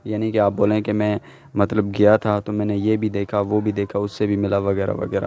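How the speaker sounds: ordinary delivery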